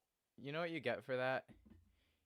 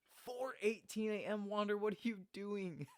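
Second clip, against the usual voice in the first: high-pitched disbelief; incredulous; 8 semitones higher than usual. Real, not acted. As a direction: exclaim in mock-scolding amazement. Astonished at viewer's early wake-up.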